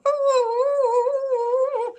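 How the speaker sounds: bright high burst of laughter; a real little laugh. Real, not acted. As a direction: laugh at yourself, amused. Laughs at her own humming.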